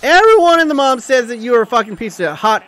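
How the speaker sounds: loud, high-pitched outburst trailing down; indignant streamer rant; worked-up rant. Real, not acted. Rant about slander of keeper.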